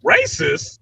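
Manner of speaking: high, incredulous mock squawk; mock outrage. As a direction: rise way up in fake disbelief. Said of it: "I'm like".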